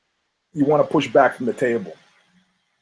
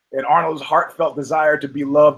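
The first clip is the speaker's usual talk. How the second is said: normal talking voice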